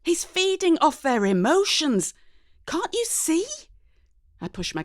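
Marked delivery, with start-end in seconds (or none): none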